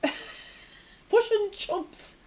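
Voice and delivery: "Fush and chumps" drawn out slowly, high; "Fush and chumps", i turned to u, after a laugh; attempted Kiwi vowels. Shown as a demonstration. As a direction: say "Fush and chumps" as exaggerated Kiwi fish and chips. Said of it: "not even getting the accent right".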